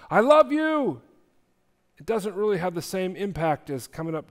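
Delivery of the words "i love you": high, loud call out the door; a hurried shouted goodbye to the kids; shouted I love you. Retold or imitated. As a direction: yell it while rushing off. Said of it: "and you shout".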